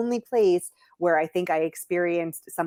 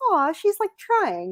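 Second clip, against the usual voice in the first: high, cooing "aw", much higher; affectionate locals; 11 semitones higher than usual. Retold or imitated. Locals praising a struggling traveller, high cooing. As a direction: coo over the foreigner's effort. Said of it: "They'll be like".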